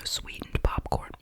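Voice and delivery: ordinary talk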